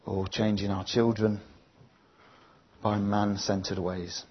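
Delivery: slow, earnest prayer read line by line. Acted. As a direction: pray it slowly, leaving room after each line. Leads the congregation in prayer.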